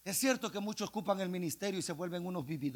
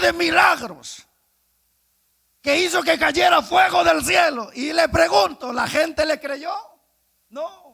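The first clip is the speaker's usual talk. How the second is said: loud, high preaching at full force; fiery preaching; 11 semitones higher, 22 dB louder than usual. Acted. Preacher shouting the story of the cross to the church. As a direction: shout it to the congregation.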